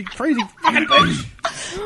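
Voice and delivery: high, slow laughing outburst; laughing mock shout. Mock outrage while laughing.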